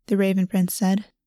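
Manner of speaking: ordinary delivery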